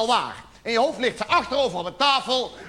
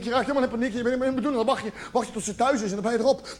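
ordinary talk, nothing marked

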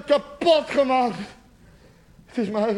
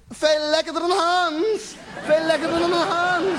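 high, slow, stretched-out cry; mock ecstatic cry; 6 semitones higher, slower than usual. Imagined girlfriend crying out with another man, high mock cry. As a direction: cry it out high, drawing out the last word. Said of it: "En ze schreeuwt".

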